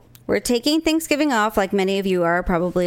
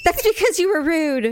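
laughing mock scold; 8 semitones higher than usual.

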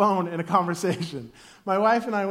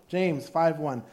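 ordinary delivery

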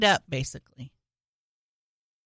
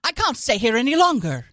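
louder mock melodrama; old-timey movie star; 3 semitones higher, 5 dB louder than usual. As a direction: declare it like a classic film heroine. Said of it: "a really old-timey movie".

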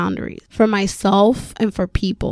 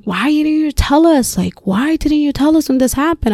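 higher-pitched, incredulous retold question; imitated reproach; 6 semitones higher than usual. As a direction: act out upset friends demanding an answer. Friends reproaching her, retold.

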